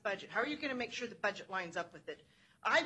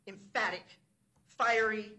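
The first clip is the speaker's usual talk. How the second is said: usual speaking voice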